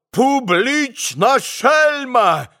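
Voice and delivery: high, slow furious cry; enraged shout. A narrator's reading. Vrublevsky threatens Grushenka with his fist, high enraged cry. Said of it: "сконфуженный и взбешённый ... закричал".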